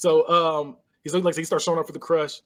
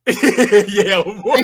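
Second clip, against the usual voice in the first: laughing; 5 semitones higher, 8 dB louder than usual. Retold or imitated. Laughing retelling.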